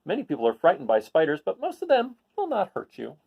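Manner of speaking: picture-book read-aloud voice; reading to children. Acted. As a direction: read it to a class of kids.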